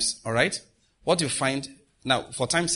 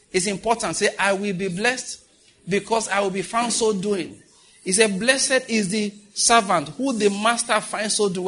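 raised-pitch, insistent sermon delivery; fervent preaching; 7 semitones higher than usual. Real, not acted. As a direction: urge the congregation to declare it. Preacher urges declarations on congregation.

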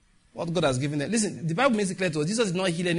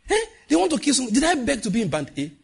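high, louder mock complaint; complaining customer; 9 semitones higher, 4 dB louder than usual. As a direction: gripe about the electricity band.

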